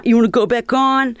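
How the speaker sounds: mock yell from the promoter; calling out again. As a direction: press her to go back on. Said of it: "he's yelling at me".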